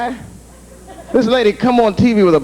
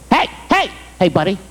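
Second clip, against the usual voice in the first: bouncer yelling; 3 semitones lower than usual. Retold or imitated. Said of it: "started yelling at me".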